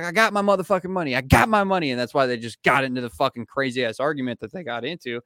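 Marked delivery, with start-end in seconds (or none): 0.0-1.9 mock angry comeback. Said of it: "He was like".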